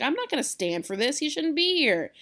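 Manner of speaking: Tybalt's protest in modern words; indignant paraphrase. Retold or imitated. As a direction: complain like a hothead teen. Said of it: "He's like".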